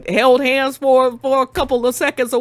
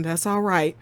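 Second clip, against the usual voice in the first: mock depressed; 6 semitones lower, 9 dB quieter than usual. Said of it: "the most depressed look ever".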